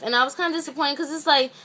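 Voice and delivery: normal talking voice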